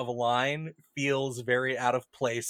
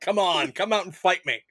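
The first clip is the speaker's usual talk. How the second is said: raised, louder taunt; playful fighting challenge, pitched up; mock challenge; 4 semitones higher, 5 dB louder than usual. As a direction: call the enemy out to a fight. Paraphrasing Aragorn's challenge at the gate.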